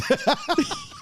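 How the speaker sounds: squealing burst of laughter; real shrieking laugh; high laughter. Real, not acted. Cracking up again.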